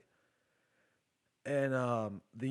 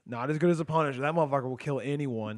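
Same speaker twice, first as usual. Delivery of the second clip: hyped echo; 4 semitones higher, 7 dB louder, faster than usual. Real, not acted. Echoing and hyping Punisher.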